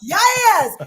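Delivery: high, loud cheer; excited yes. Real, not acted. Guest cheering as she reveals her pick, high and loud. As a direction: punch it out like a victory shout.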